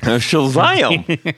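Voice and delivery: drawn-out high hillbilly shazam; a hint of Gomer Pyle. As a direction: stretch it slow like Gomer Pyle. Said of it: "all the things that he says".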